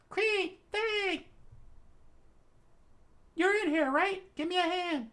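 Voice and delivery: put-on high girl voice, calling urgently; Latla calling out. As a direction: shout up to the orca for help. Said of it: "and screamed out".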